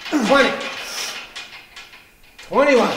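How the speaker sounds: calling out reps. Real, not acted. Counting reps aloud at gym.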